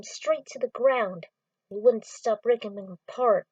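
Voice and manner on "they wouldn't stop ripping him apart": usual speaking voice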